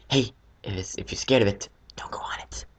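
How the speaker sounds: put-on soft, whispered reassurance to a scared kid; hushed advice. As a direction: breathe it almost voiceless, gently. Quoted advice to cousin.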